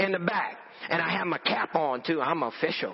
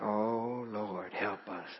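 much softer, slow plea to the Lord; whispered prayer; 8 semitones lower, 8 dB quieter, much slower than usual. Retold or imitated. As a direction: breathe it out as a private prayer. Silent prayer amid the riot.

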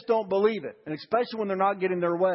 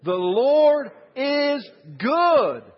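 much louder and higher, very slow; loud call and response; 6 semitones higher, 6 dB louder, much slower than usual. Shown as a demonstration. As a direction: proclaim it for all to repeat. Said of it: "Will you say that with me".

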